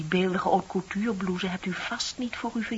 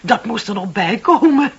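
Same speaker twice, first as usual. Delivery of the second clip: loud laugh through the words; laughing, almost barking the line; laughing scoff; 3 semitones higher, 12 dB louder than usual. Acted. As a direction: laugh it off, scornful. Scornful laugh at the suggestion.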